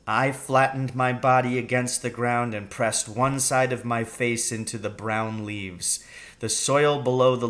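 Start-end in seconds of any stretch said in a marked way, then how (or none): none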